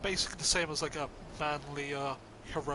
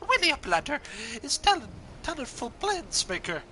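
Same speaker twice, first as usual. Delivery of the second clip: high, loud garbled hero; high loud goofy voice; 6 semitones higher, 4 dB louder than usual. Acted. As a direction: squawk the praise out. Raised comic NPC voice.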